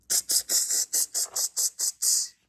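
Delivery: soft unvoiced tss tss; monkeys tsking. A narrator's reading. Monkeys mocking the peddler with soft tsks. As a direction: click back at him like cheeky monkeys. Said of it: "the monkeys only shook their fingers back at him and said".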